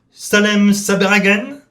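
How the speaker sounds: loud, high call across the waiting room. Acted.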